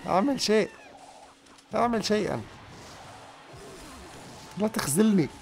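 high-pitched, hammed-up begging; mock pleading. Player begging his game troop for help, melodramatic high plea. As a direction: plead theatrically, pitched well up.

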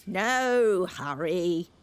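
very slow, unhurried carpenter. A narrator's reading. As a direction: drag it out lazily. Carpenter answers.

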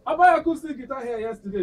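loud indignant shout; yelling across the room. Acted. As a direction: bellow it out, annoyed. Repeats his demand louder.

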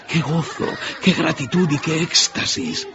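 high, rising, delighted narration; joyful exclamation. A narrator's reading. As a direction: exclaim the children's joy with delight. Narrator exclaims children's joy.

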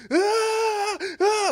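high, loud cartoon wails; panicked cartoon screams. Disciples running round the boat, wailing high in cartoon panic. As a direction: scream like SpongeBob running in circles.

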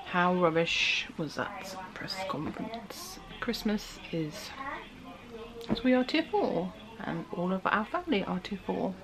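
hushed, slow whisper. Real, not acted. Whispered complaint in vlog.

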